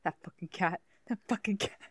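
quiet, repeated mock grumble; stewing anger. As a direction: grumble it softly, imitating a stewing fiancé. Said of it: "he was so mad. Just stewing".

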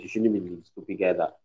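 ordinary talk

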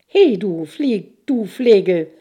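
loud, slow angry shout at the intruder; angry call. A narrator's reading. Princess shouting at the soldier hidden in the cage, angrily. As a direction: bellow it like an outraged princess. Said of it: "rief Jelena".

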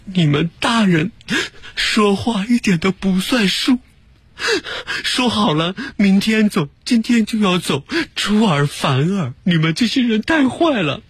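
mimicking a crying child. Narrator imitates a crying kid scolding the adults. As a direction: play the sobbing kid scolding grown-ups.

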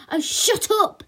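high, loud retort; embarrassed girl silencing classmates; cross, irritated outburst; angry snap. A narrator's reading. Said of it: "snapped the girl".

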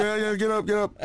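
high, frantic retold get up; very high panicked urging. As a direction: hurry the wife out of bed. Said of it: "And I was like".

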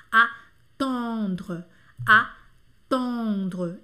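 attendre said with a rounded nasal on. Shown as a demonstration. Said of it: "O céu da boca fica redondo". (attendre com nasal on arredondado)

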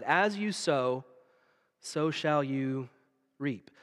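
slow, weighty verse, fading to a whisper on reap; hushed slow scripture; slow solemn recitation. Shown as a demonstration. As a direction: recite it like the preacher starting the verse.

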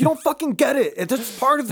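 high, mock-exasperated comeback; exasperated retort. Festival regular answers complaining friends, mock exasperated.

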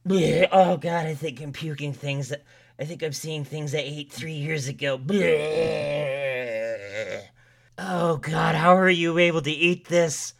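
high, loud pained gagging; retching and gasping. Acted. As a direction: choke out words between heaves. Keeps vomiting and complaining.

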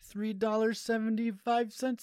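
put-on hammy Indian accent, mulling prices. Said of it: "make an impression of Abrar".

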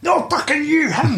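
high, put-on yell of correction; exasperated shout. Manager shouting at the wrong player. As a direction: yell it, fed up.

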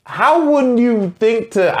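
high-pitched exasperated disbelief; incredulous. Real, not acted. Friend baffled you never subscribed, incredulous.

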